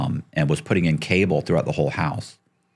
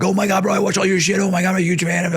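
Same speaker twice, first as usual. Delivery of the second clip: much higher, gushing fan; excited fan; 11 semitones higher, faster than usual. Retold or imitated. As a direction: gush like a starstruck fan. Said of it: "The guy's like".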